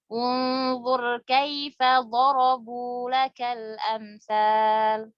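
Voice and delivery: very slow, elongated recitation; Quran recitation. Shown as a demonstration. Teacher reciting the example of an imperative verb, slow recitation. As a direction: draw out the long vowels evenly.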